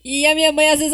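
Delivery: ordinary talk, nothing marked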